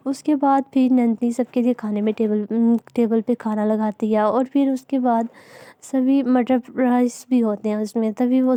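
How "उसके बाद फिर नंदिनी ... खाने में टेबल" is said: usual speaking voice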